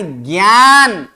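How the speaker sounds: ज्ञान as a long, slow "gyaan"; correct ज्ञान. Shown as a demonstration. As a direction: stretch ज्ञान with a gy onset. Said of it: "क्या उच्चारण करना है?". (ज्ञान को खींचकर "ग्यान" बोलना)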